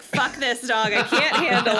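loud, laughing mom impression; fed-up mother laughing it out; mock frazzled mom, laughing. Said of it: "She's like".

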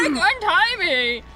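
hammed-up captive father begging; Doctor Brewer voice. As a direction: stretch it out in a silly, urgent voice. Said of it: "Doctor Brewer said".

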